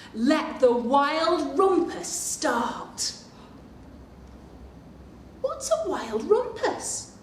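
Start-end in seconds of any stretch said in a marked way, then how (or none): none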